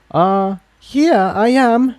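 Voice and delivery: high, hesitant mare voice; unsure, stumbling into the reply up high; timid young mare. Acted. Tiptoe answers the gruff pegasus.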